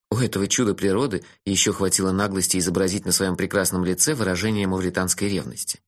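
usual speaking voice

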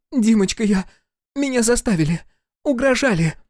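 high-pitched, pleading woman's voice; pleading wife. A narrator's reading. Wife on her knees to her husband, pleading.

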